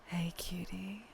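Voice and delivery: slow breathy sultry whisper; sultry narrator. Shown as a demonstration. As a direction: purr it like a flirt. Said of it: "You requested a slightly sultry tone".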